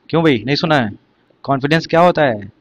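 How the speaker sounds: usual speaking voice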